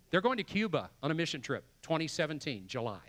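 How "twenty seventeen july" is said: normal talking voice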